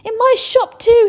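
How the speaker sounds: high loud yell, slow. Acted. Shopkeeper yells about his own shop, high and slow. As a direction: bellow it with pride. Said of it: "he yelled".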